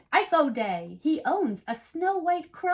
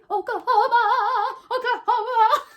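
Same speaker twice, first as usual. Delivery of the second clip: laughing through it; 9 semitones higher, 5 dB louder than usual. Acted. Repeats the name laughing.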